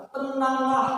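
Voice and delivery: high, loud Tenanglah; Jesus calling out. Acted. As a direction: call out Tenanglah at once. Jesus voiced spontaneously. (seruan Tenanglah yang keras)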